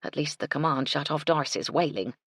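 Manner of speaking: ordinary delivery, nothing marked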